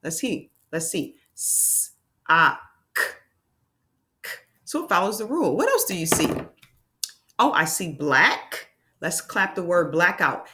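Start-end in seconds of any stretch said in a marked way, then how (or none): 1.3-4.3 "S, ah, k" broken into hiss, short vowel, bare k; sounding out. Shown as a demonstration. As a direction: say "S", "ah" and "k" apart, repeating the final k. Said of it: "do I hear a k".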